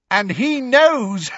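high, loud, drawn-out taunt; mad glee. A narrator's reading. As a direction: taunt with manic triumph. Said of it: "with a mad laugh to match Bellatrix's own".

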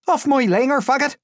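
very high, loud outburst; outraged high-pitched yell; angry captor. A narrator's reading. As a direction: shout him off indignantly. New captor snapping at prisoner.

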